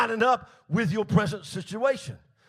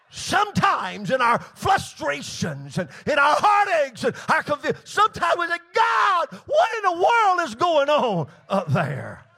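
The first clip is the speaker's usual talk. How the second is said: exasperated preaching; 6 semitones higher, 6 dB louder than usual. Acted. Preacher voicing believers' frustration with God, exasperated cry.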